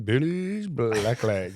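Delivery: slow, stretched sing-song call; imitated workmate's long-drawn taunt; drawn-out teasing nickname. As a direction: draw each word out mockingly. Workmates' nickname chant retold.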